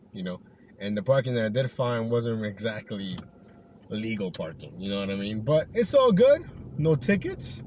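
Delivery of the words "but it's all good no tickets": relieved excitement. Real, not acted. Relief after illegal parking.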